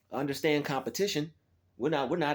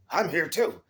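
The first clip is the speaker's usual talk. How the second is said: mock rival dude; 3 semitones higher, 5 dB louder than usual. Mocking rival dude's claim.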